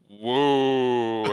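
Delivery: long, slow imitated "woo"; drawn-out woo. Teasing Marshall with his woo, long and drawn out. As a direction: stretch out a mock Ric Flair woo.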